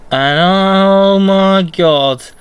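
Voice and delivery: drawn-out, high and loud oh my god; excited gasp. Real, not acted. Fan gushing as Twisted comes out, slow and loud. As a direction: gush over the clown's entrance.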